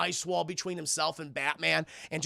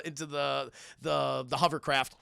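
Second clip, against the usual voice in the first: normal talking voice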